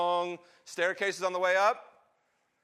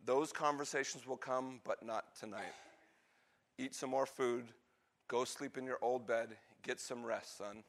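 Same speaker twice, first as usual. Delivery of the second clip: soft, lower, soothing reassurance; wise father; 4 semitones lower, 8 dB quieter than usual. Acted. Father sending his weary son to bed, gentle. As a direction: hush him gently, voice dropping low. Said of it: "being a wise father".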